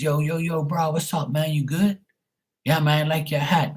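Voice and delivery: high-pitched chummy greeting; imitated friendly panhandler. Imitates man greeting him at store.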